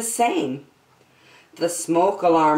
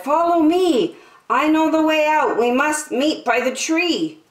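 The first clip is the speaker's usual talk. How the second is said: put-on child leading; 11 semitones higher than usual. Child guiding dragon through smoky hallway, high and urgent. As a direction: urge the dragon to follow you.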